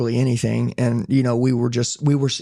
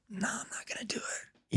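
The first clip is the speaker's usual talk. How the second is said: breathy whisper, higher; whispered refusal; 6 semitones higher, 17 dB quieter than usual. Retold or imitated. At the altar call, refusing silently in a whisper. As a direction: hush it as an inner voice.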